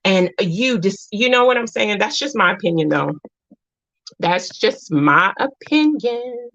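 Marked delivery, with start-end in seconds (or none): 4.2-6.5 drawn out, higher, word by word; slow emphatic disclaimer. Real, not acted. Repeating her disclaimer to listeners, slow and emphatic. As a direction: stretch each word out deliberately.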